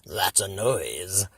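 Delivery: low, slow sly villain; grinning Grinch. Acted. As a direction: savour it with a wicked grin. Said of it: "grinned the Grinch".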